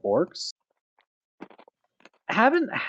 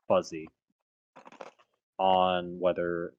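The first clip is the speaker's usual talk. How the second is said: ordinary talk, nothing marked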